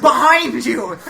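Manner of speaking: high, loud comic shout; loud exasperated yell. Retold or imitated. Comic answering diner at the bar, loud exasperated shout. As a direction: yell it back over the TV. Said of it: "I was like".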